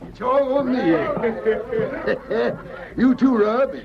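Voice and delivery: high, boisterous host treating the bar with a laugh; jovial celebrating. Acted. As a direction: throw a round for everyone, laughing and expansive. Miner buys drinks, celebrating.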